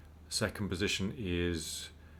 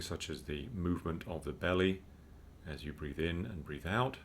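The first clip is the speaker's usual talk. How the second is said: calm, unhurried meditation guide; measured mindfulness instruction with spacious pauses; guided meditation; 4 dB quieter than usual. Real, not acted. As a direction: guide listeners gently into breathing, with long pauses.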